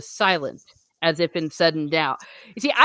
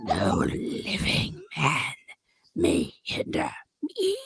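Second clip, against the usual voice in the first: demon voice, whispered and eerie; demonic whisper; 13 semitones higher, 3 dB quieter than usual. Shown as a demonstration. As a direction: rasp it like a demon. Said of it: "I'll do my demon voice".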